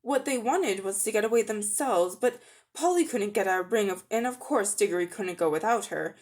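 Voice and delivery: ordinary delivery, nothing marked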